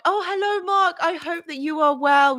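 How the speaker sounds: high, bright greeting to a new viewer; light up as Mark arrives; delighted greeting. Real, not acted. Greeting viewer Mark in the live chat, delighted.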